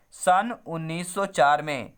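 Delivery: "सन उन्नीस सौ चार में" said slowly, each word set apart; teacher slowing down so the year sticks; slow drilled answer. Shown as a demonstration. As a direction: spell the year out slowly for the class to memorise. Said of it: "कब दिया था भैया?".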